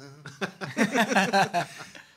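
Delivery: high hearty laugh; loud laugh. Real, not acted. Laughs at the sweet illusion.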